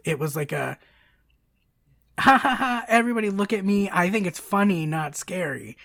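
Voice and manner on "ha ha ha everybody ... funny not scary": loud, high, showy fake laugh into a boast; mock forced laugher. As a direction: imitate a friend laughing too loudly for attention. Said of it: "not like, a normal laugh".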